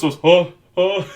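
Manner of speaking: loud, high surprised oh; startled yelp. Retold or imitated. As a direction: yelp as the bucket drops. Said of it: "He goes".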